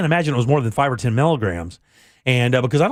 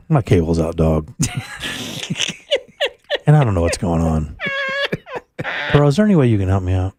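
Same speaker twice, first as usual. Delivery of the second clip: dazed, laid-back plea; 6 semitones lower than usual. Retold or imitated. Host on Xanax calling the cable company, low stoned plea. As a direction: plead like a sedated guy calling for help.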